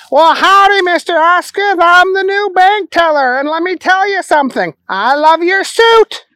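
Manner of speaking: high, silly simpleton voice; Booger. Acted.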